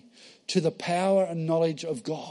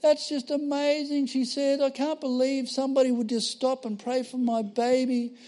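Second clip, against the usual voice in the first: higher, moved, amazed voice of the weeping lady; tearful pregnant lady; 8 semitones higher than usual. Retold or imitated. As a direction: lightly voice the woman moved to tears. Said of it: "And she goes".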